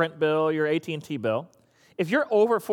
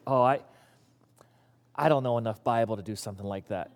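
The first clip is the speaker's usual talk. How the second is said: mumbled, lower reluctant excuse; whiny excuse; 9 semitones lower, 5 dB quieter than usual. Retold or imitated. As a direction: play a hesitant volunteer who backs out, lower and quieter.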